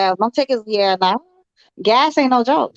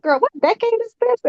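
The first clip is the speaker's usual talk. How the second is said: incredulous; 9 semitones higher than usual. Retold or imitated. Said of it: "I'm like".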